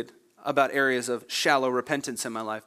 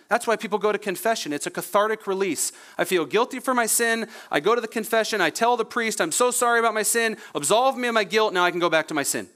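raised, insistent pulpit voice; impassioned preaching; 7 semitones higher, 3 dB louder than usual. Real, not acted. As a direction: preach it with urgency, pitched up. Preaches with rising force.

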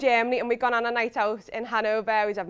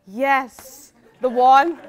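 bursting into a laugh, much louder on the one; 10 dB louder, much slower than usual. Retold or imitated. Bride recalling her thought, laughing loudly. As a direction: laugh out the words, delighted.

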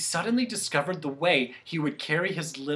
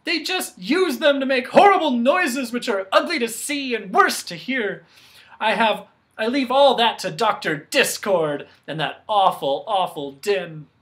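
louder, higher indignation; indignant rant; 6 semitones higher, 7 dB louder than usual. Acted. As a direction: rail against the awful din. Soundkeeper rants about Discord.

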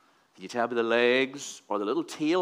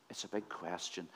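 "It's a big question" repeated much softer and lower; hushed, lower echo with "big" still stressed; 6 semitones lower, 15 dB quieter than usual. Shown as a demonstration. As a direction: drop right down, almost to yourself.

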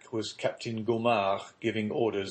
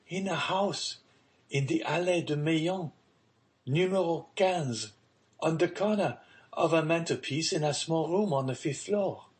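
put-on higher-pitched girl explaining eagerly; young daughter's voice; 6 semitones higher than usual. A narrator's reading. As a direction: answer father in a high, girlish voice. Daughter answers father in story.

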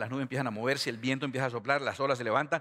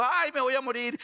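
high-pitched hammed-up wail; mock crying; 10 semitones higher than usual. Disciple tossed in the storm fearing death, mock crying. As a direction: wail like you are done for, playfully. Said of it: "y luego llorando".

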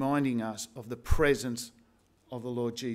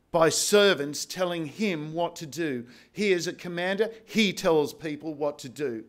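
forceful raised preaching voice; 3 semitones higher, 4 dB louder than usual. Acted. Pastor drives home the point to the congregation, full preaching force. Preaching on God humbling pride.

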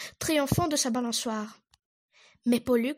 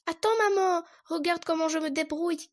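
eager shout; 5 semitones higher than usual. Acted. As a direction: show off, calling for attention. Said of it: "cria Castor".

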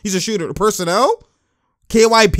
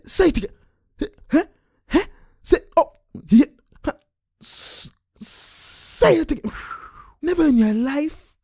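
mock threatening player; 10 semitones higher than usual. Streamer voices the player challenging his opponent, high mock threat. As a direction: play the riled-up player, pitched way up. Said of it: "He's like".

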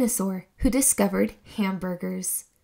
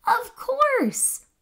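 excited realisation; 13 semitones higher than usual. Acted. Scout finding pickle flowers, thrilled eureka. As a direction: shout the discovery with joy.

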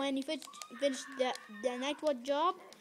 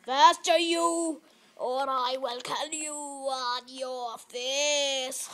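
loud mock-menacing put-on voice, slow; hammed-up villain voice; silly threatening voice; 7 dB louder, slower than usual. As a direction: growl a cartoonish threat at the horse. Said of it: "Sorry for my stupid voice".

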